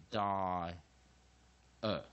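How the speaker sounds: long 'die', pause, short '呃'; syllables separated. Shown as a demonstration. Teacher showing how to separate vowels, long 'die' then short '呃'. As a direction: split it into stretched 'die' and detached '呃'. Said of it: "要分开来念".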